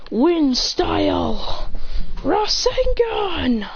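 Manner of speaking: very drawn-out, high anime attack call; slow mock shout of a special move; battle cry. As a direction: stretch each move name into a rising battle cry. Attack technique called out.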